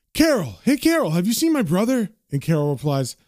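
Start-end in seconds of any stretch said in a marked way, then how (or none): 0.1-2.1 higher-pitched call for attention. Acted. George asks Carol about his brother, calling out. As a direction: hail someone across a busy counter.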